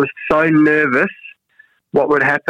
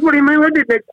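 incredulous; 12 semitones higher, faster than usual. Retold or imitated. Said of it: "I said".

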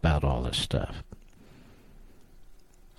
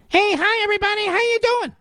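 much higher squeaky mock voice, like Minnie Mouse; Brian Stelter impression. Radio host mocking a rival anchor's voice, high squeaky falsetto. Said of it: "with his prepubescent voice, he sounds like Minnie Mouse".

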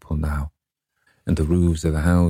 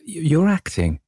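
put-on a young woman's anxious plea, pitched up; higher, pleading girl; 12 semitones higher, slower than usual. A narrator's reading. Sibyl begging Dorian not to mean it, high pleading voice. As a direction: plead with him in a high girlish voice.

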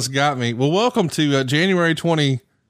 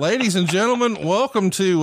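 higher-pitched show-opening announcement; announcer welcome; 7 semitones higher than usual. Acted. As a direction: welcome the crowd like a ring announcer.

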